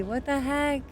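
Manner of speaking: ordinary talk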